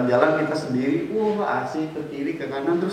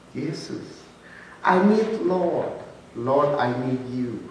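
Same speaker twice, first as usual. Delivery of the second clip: pleading prayer; much slower than usual. Acted. Prayer-like plea. (doa memohon pelan dan lambat)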